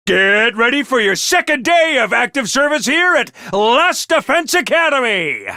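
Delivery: ordinary talk, nothing marked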